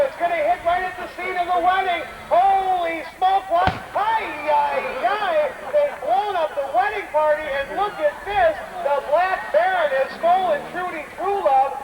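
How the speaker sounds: high, breathless play-by-play; frantic commentary. Acted. Announcer describes the wedding bombed, high and frantic. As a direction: pile on the panic for the show. Narrating staged bombing.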